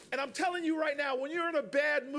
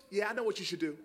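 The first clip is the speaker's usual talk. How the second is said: low, confiding imitation of the neighbor; teasingly withheld wisdom, pitched down; neighbor's knowing murmur; 7 semitones lower, faster than usual. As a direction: drop low as if sharing a secret. Neighbor drawing out her big reveal.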